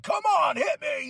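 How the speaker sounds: high, loud call to bring it on; taunting shout. Retold or imitated. Vlogger imitating Charlotte taunting her opponent, a high loud shout. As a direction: yell the dare at her opponent. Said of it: "even Charlotte's like".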